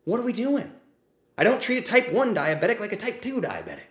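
loud, high, fired-up ranting; heated rant. Real, not acted. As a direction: hammer the point home, worked up. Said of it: "I literally just made myself speechless with that rant".

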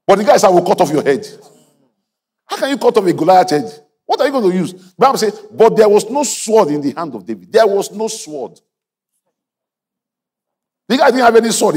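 high, loud incredulous preaching. Acted. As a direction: hammer the point with disbelief. Preaching at full force.

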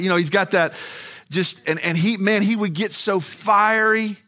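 high, loud, slowing on so fiery; fiery preaching. Real, not acted. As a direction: preach it with fire. Preaching at full force.

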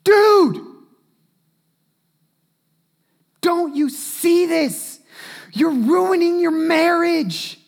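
urgent friend. Acted. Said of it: "loves you enough to go".

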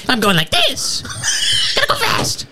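squeaky, very high impersonation; absurdly high comic voice rising to a squeal. Retold or imitated. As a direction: push the imitation way up high. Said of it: "he's got a higher pitched voice than that".

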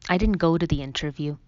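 ordinary delivery, nothing marked

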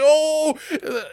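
excited gasp. Retold or imitated. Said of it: "I was like".

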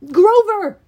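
loud, high urgent call; yell. A narrator's reading. Hero calls his friend across the bridge, urgent yell. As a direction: shout the name across a distance. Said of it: "I yelled".

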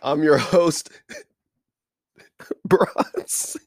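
name dissolves into a breathless laugh; fighting the giggles, losing; laughing through it. Real, not acted. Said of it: "the reason why I'm laughing".

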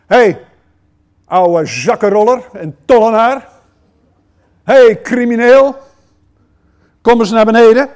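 loud, scornful hey to a thief; mock rude shout at a criminal; rough shouted call. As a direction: bark it up at the crook in the tree. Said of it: "Dan zegt hij niet tegen Zacchaeus".

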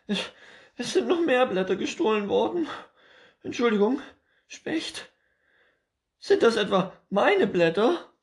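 very high, loud squirrel voice, quick and frantic; panicking squirrel. Acted. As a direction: cry out in alarm about the leaves.

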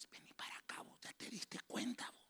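hushed, conspiratorial summons; gossiping whisper. Shown as a demonstration. Preacher showing how gossip is told, whispering. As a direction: draw someone aside in a secretive whisper. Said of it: "no grita, el chambre se dice".

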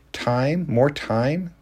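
rising, disbelieving repeat of the word; incredulous echo. A narrator's reading. Mary to Tariq, scoffing at his plea, incredulous. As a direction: throw his words back in disbelief.

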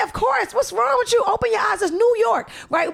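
high-pitched, emphatic indignation; indignant retort. Acted. Mother setting someone straight about New York, indignant and high-pitched. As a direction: exclaim it as if it's obvious.

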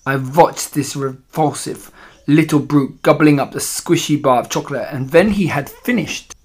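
put-on accented villainess recounting her trap. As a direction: tell your followers with relish, in a foreign accent.

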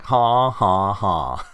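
sinister villain laugh, ha ha ha; mock evil cackle; evil chuckle. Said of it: "That's a sinister laugh".

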